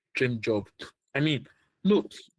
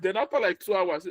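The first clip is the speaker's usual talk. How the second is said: normal talking voice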